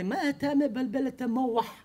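put-on slow, scolding Yemenite old man; lightly voiced grumpy neighbor; Yemenite neighbor yelling. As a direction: nag him like an angry neighbor. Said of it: "שעה צעק עליי".

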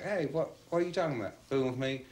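high, brash challenge to the policeman; scoff, who are you fooling with; confident attitude. Retold or imitated. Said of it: "he was real confident".

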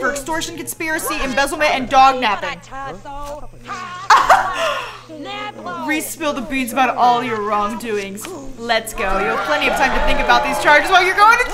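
reading the cop's lines with a mock stern edge; voicing a stern accuser. Streamer reading a game character's accusation, mock stern. Reads Sims character dialogue.

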